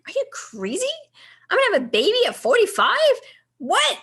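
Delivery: high, loud, fast disbelief; incredulous outburst. Retold or imitated. Telling her husband about a baby at forty-five, high loud disbelief. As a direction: rattle it off in shocked disbelief. Reacting to pregnancy at 45.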